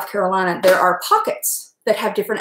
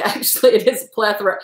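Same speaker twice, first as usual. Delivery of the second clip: usual speaking voice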